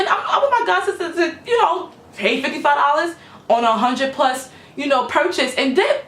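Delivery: laughing through the words, pitch up high; laughing while telling. Real, not acted. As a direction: giggle as you get to the price. Giddy about the discount.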